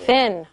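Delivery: "Thin" with a short I, as in sit; short I sound. Shown as a demonstration. Teacher confirming word before adding E, short I sound. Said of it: "That's the I sound of the I".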